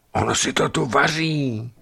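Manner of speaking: high, strained stage whisper in alarm; aghast, high-pitched hushed exclamation; horrified whisper. A narrator's reading. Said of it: "šeptám zděšeně a ustupuji o krok".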